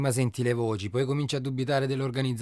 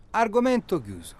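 insistent; 9 semitones higher, 4 dB louder than usual. Acted. Friend ending topic insistently.

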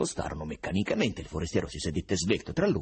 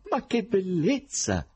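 delighted exclamation; 13 semitones higher, 4 dB louder, slower than usual. A narrator's reading. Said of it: "esclamò il sorprendente forestiero".

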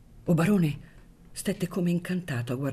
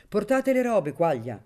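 loud, higher official command; commanding order; 6 semitones higher, 4 dB louder than usual. A narrator's reading. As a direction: bark the order across the room. Judge orders the usher. (ordine secco e forte del giudice)

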